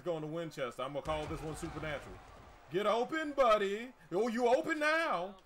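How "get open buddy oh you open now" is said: loud, high urging the receiver on; excited cheering. Real, not acted. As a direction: shout encouragement as he breaks free.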